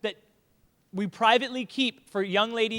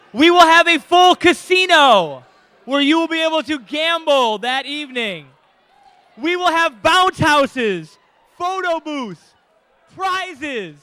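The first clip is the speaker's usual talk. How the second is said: loud, slow, higher hype; announcer hype; 5 semitones higher, 9 dB louder than usual. Real, not acted. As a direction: sell it like a game show host. Hyping party features.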